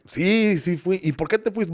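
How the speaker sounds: lightly hammed-up family exchange; mock confession. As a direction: play a kid owning up to parents. Acts out confessing to questioning parents.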